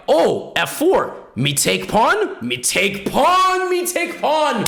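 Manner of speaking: high, slow dim-witted caveman voice; slow mock-primitive chant, rising high; caveman player. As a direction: drag out each word like a greedy caveman. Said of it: "here's what goes through the player's head".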